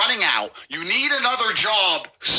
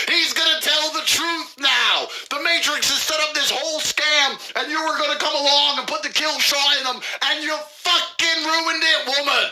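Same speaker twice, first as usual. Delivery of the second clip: mock rant shout; 8 semitones higher than usual. Ranting mock accusation.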